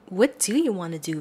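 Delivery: usual speaking voice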